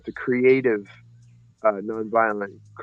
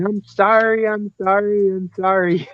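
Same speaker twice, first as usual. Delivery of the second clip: much higher, pleading mock sobbing apology; a hint of tearful remorse, retold; imitating a bawling man; 9 semitones higher, 3 dB louder than usual. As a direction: voice a drunk fighter breaking down in tears. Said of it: "they were just bawling".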